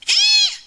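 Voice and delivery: shrill high eek of embarrassment; high squeal. Acted. Amy seeing her skirt slipped off, high squeal. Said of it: "she cried out".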